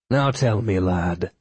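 stagey Irish lilt tinged with Icelandic; faux Irish accent. A narrator's reading. Lawyer questioning the shopkeeper in faux Irish accent. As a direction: put on your best fake Irish brogue. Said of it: "he said in his best faux Irish accent tinged with ancient Icelandic".

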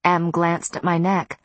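ordinary delivery

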